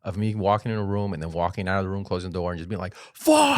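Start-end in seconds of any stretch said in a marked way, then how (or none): 3.1-3.6 high, loud burst of swearing; hammed-up scream of frustration; retold frustrated yell. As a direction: yell it as if behind a shut door. Retold yelling behind closed door.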